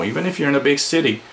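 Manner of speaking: ordinary talk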